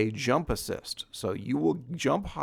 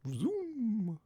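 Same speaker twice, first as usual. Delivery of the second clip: high, drawn-out zooming noise; playful zoom; 11 semitones higher, 5 dB quieter than usual. Pretend. Showing off new speed to viewers, playful high zoom.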